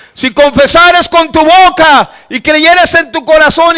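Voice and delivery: high, loud, slow scripture shout; fervent full-voiced proclamation; shouted recitation. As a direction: shout the verse out word by word. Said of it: "Y yo empecé a gritar".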